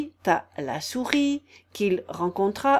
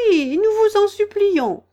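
very high, loud entreaty; one reader acting a begging crowd; chorus of pleading; 12 semitones higher, 9 dB louder than usual. A narrator's reading. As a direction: beg eagerly, voice pitched right up. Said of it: "tous les autres dirent en chœur".

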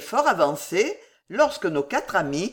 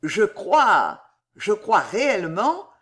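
plain reading voice

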